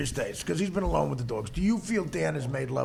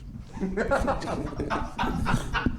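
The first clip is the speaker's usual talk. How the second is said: unvoiced laugh; 2 semitones higher than usual. Real, not acted. Laughing at the dog voice.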